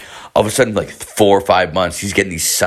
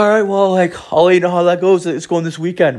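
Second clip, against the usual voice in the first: much higher, light mock buddy voice; buddy's reply; 11 semitones higher than usual. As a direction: toss it off high like the buddy. Buddy voiced in retold chat.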